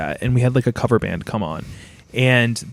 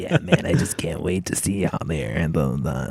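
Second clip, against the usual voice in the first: creepy whispered caller; 7 semitones lower than usual. Retold or imitated. Imitating the odd concert caller in a low whisper. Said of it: "He's like".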